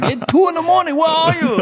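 high-pitched mock-angry Thai accent; pissed Thai wife. Wife suspecting a strip club, pissed imitated Thai accent. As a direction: imitate a suspicious wife demanding answers in Thai-accented English. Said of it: "Now she's pissed".

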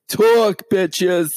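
high, loud brassy bark; brash comedienne command; Joan Rivers. Retold or imitated. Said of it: "as Joan, Joan Rivers would say".

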